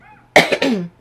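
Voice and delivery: loud real cough; one hard cough. Real, not acted. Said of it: "I'm sorry".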